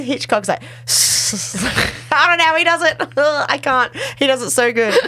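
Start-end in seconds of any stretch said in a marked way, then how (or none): 0.6-2.1 long unvoiced hiss like a snake; mock snake hiss. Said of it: "Hi, Snake". 2.1-4.9 helpless giggles, pitched up; cracked up and barely getting words out; laughing. Real, not acted. Cracking up at own hiss imitation.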